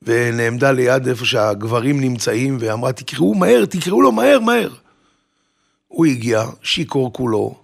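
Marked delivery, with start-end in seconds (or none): 2.9-4.7 higher, urgent retold call; imitating an anxious woman calling for help. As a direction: act out hurriedly summoning someone. Daughter urgently calls for drunk groom.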